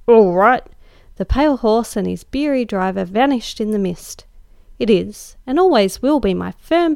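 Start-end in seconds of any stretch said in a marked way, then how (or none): 0.1-0.6 a loud gruff grunt of agreement. Acted. Old gentleman dismissing the cabby, gruff and loud. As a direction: bark it like a brusque old gentleman. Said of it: "with a final gruff".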